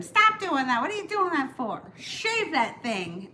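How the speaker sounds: high, loud scolding sister-in-law; imitated yelling. Sister-in-law yelling at her brother-in-law about his beard habit, mock yell. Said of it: "my sister-in-law was yelling at him".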